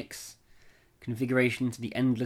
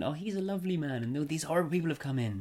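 high, doting voice full of pity; sympathetic gush; 6 semitones higher, faster than usual. Retold or imitated. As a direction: gush over the kind old man.

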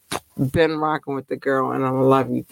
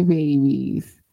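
ordinary talk, nothing marked

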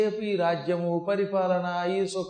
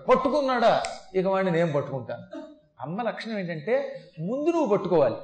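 usual speaking voice

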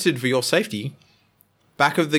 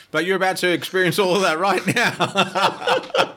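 laughing tease; 6 semitones higher, slower than usual. Real, not acted. Teasing threat, laughing.

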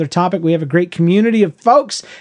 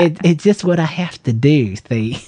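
silly sing-song cartoon voice; goofy bouncy cartoon delivery; exaggerated cartoon intonation; 4 semitones higher than usual. Real, not acted. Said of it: "Why are you talking like that?".

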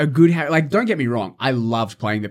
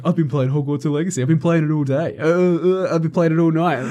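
high, gloating singsong; mocking a smug gamer; 6 semitones higher, 3 dB louder than usual. Mocking a friend who's been playing all day, in a gloating voice. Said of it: "And then you come in like".

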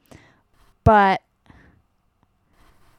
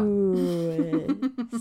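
ordinary talk

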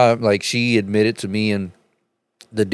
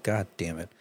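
quiet, lower aside of retold annoyance; low exasperated grumble; 4 semitones lower, 12 dB quieter than usual. Driver hears asshole is coming along, low exasperated grumble. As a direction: grumble it softly to yourself.

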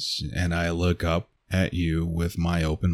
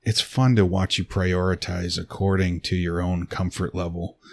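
normal talking voice

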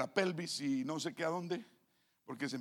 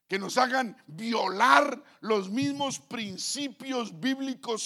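slow, loud, hammering exhortation; preaching at full force; 3 semitones higher, 8 dB louder, slower than usual. Acted. Pastor warning the church against fear, thundering. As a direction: drive each word home slowly.

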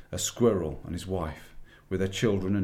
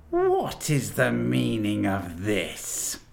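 slow, louder, menacing queen's question; witch queen demanding; 2 semitones higher, 5 dB louder, much slower than usual. A narrator's reading. Witch queen confronts the frightened party, slow and menacing. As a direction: loom over them with each word. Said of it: "asked the witch queen".

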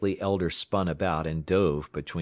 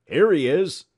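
loud, high pursuer's shout; raised cry on spotting the quarry; 9 semitones higher, 9 dB louder than usual. A narrator's reading. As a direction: shout to the others. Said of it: "they shouted".

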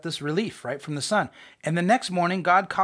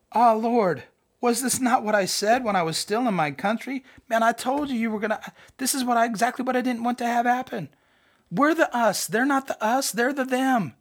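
high-pitched, indignant mock complaint to God; angry Jonah; 8 semitones higher than usual. Jonah griping to God over his message to Nineveh, mock-angry. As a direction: act out an exasperated protest, pitched well up. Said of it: "How angry was he?".

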